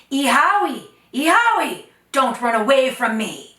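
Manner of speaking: loud, high call from afar. Acted. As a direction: call her back across the plain. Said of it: "calling out".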